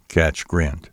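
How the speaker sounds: ordinary delivery, nothing marked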